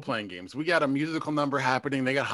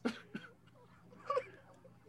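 laughing; 10 semitones higher, slower than usual. Real, not acted. Overwhelmed by a bit.

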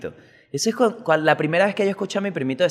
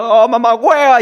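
put-on high, loud hurt toddler cry of Oh, mamá hueva; little kid cursing; 8 semitones higher, 9 dB louder than usual. Toddler scratched in the eye yelling at mom, high and loud. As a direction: yell it like a small child hurt in the eye. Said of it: "Y se puso y dijo".

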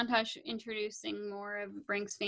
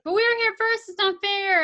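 whiny protest; 9 semitones higher, 8 dB louder than usual. Retold or imitated. Skaters protest.

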